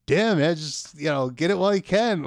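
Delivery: mock pitchman urging, voice lifted; put-on sales hype. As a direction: act out half-hearted hype for the camera. Acting out forced sales hype on video.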